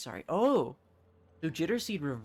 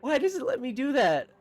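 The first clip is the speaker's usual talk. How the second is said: high, loud, baffled outcry; amused incredulity; 10 semitones higher, 6 dB louder than usual. Real, not acted. Gamer baffled by what the game allows, loudly incredulous. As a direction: exclaim it in raised-pitch disbelief.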